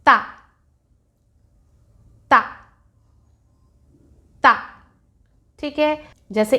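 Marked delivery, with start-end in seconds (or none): 0.1-4.6 ता with the tongue between the teeth, crisp and clear; isolated ता, each one set apart and exaggerated; ta sound demo. Shown as a demonstration. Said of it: "हम ता बोल रहे हैं इस तरह से".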